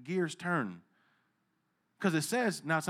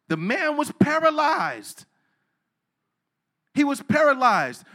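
emphatic preaching; 8 semitones higher, 7 dB louder, slower than usual. Acted.

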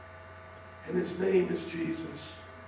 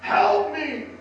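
loud, higher, desperate yelling at the explainer; furious shout; 8 semitones higher, 10 dB louder, slower than usual. Retold or imitated. Person with a broken leg yells at the explainer, a desperate shout. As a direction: bellow it like the person with a broken leg begging for help. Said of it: "would be like".

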